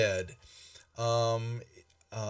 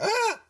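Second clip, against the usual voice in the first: very high startled "ah"; short falsetto yelp of alarm; startled shriek. Acted. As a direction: let out a squeaky little shriek. Game master voices startled halfling.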